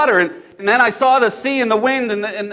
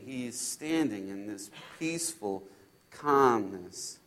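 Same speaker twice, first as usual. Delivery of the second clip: low, soft, slow storytelling; slow down and hush, with reverent pauses; hushed awe; 8 semitones lower, 15 dB quieter than usual. Acted. Disciple recalling Jesus lifting him from the storm, hushed awe. Retelling the rescue in calm.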